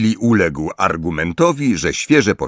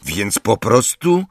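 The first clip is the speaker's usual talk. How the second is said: higher, decisive; firm; 6 semitones higher than usual. A narrator's reading. Ron insisting they break their word, firm. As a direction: lay down the rule flatly. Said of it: "oświadczył stanowczo Ron".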